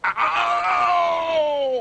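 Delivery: high, pained ow; cry of pain. Acted. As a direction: wail with a bitten thumb. Dentist in pain.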